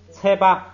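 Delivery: 切八 with 切 high and level; 切 level, no fall; sandhi demo. Shown as a demonstration. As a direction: read 切八, keep 切 flat and high. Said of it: "第三个".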